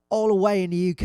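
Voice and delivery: badly faked Manchester accent. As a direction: have a poor go at Manchester. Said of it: "I can't do the Manchester accent".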